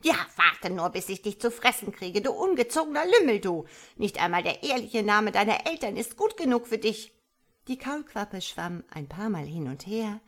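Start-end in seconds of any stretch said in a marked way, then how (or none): 0.0-7.1 loud, rising frog tirade; angry scolding. A narrator's reading. Frog mother threatens her tadpole, angry scolding. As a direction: lash out at the rude youngster.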